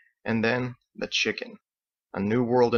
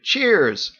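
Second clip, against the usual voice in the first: cheerful toast; 8 semitones higher, 5 dB louder than usual. A narrator's reading. Toast together.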